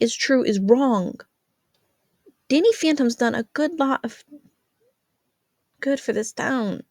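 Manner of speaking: put-on earnest teenage girl scolding her mother; Jazz arguing.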